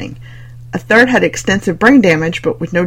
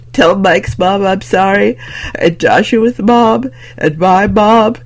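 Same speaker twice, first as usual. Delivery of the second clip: slow, snotty, tearful mock confession; Blair Witch confession; 2 semitones higher, 4 dB louder, slower than usual. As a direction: ham up a weepy confession to camera. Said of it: "his best imitation of Heather's confession tape".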